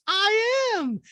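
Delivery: high, loud mock retort; Diana. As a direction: declare it in a squeaky falsetto impression. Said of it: "And Diana goes".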